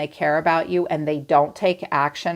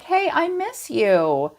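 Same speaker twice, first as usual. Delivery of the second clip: needy mock plea; 8 semitones higher, slower than usual. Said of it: "and they're like".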